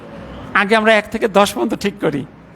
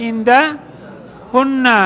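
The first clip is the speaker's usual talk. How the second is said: ইন্দাহুন্না drawn out very slowly; slow drill form; 2 semitones higher, much slower than usual. Shown as a demonstration. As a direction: stretch each syllable for the class. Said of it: "চাইলে এখানে চোদ্দটা রূপই পড়তে পারি". (খুব ধীরে টেনে পড়া)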